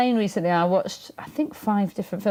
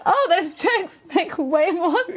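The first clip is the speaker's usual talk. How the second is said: amused realization; 11 semitones higher, slower than usual. Retold or imitated. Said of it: "I was like".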